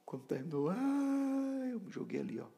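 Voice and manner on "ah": high, drawn-out mock wail; imitated wail. Preacher re-enacting his breakdown, imitated wail. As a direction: let out an acted cry of pain.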